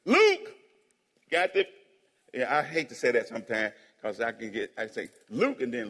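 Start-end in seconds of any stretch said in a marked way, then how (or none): 0.0-0.5 sudden high, loud call. Real, not acted. Pastor announcing the book to the congregation, high loud call. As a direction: sing out the name to the room. Calls out the book's name.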